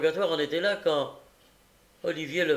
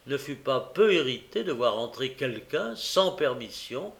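plain reading voice

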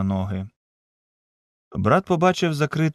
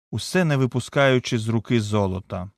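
ordinary delivery

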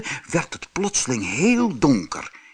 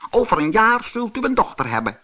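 high, fast frog voice; croaking frog; 11 semitones higher, 3 dB louder, faster than usual. Acted. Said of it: "kwaakte de kikker".

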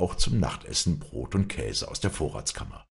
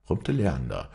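ordinary delivery, nothing marked

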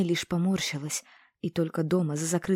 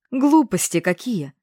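loud, slow, dismissive; scoffing snort; 4 semitones higher, 8 dB louder, slower than usual. A narrator's reading. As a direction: brush the fear aside with a huff. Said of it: "фыркнула подруга".

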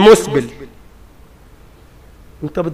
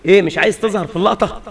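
usual speaking voice

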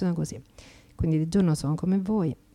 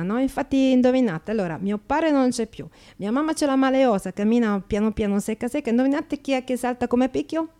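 chatty Slovak immigrant in broken Italian, brightly pitched up; a cheeky Slovak accent, lively and a little higher; 6 semitones higher, 5 dB louder than usual. Acted. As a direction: play an Eastern European woman mangling Italian grammar with cheerful confidence. Actress plays Slovak immigrant.

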